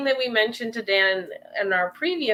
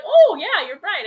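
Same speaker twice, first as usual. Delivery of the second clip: a sudden high, louder oh; mock realization; 8 semitones higher, 6 dB louder than usual. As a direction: imitate him catching on all at once. Imitating husband's sudden recollection.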